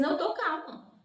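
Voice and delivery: high-pitched claim of calm; agitated insistence. Retold or imitated. Sister answering woman who said calm down, agitated retelling. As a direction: insist on calm while clearly rattled.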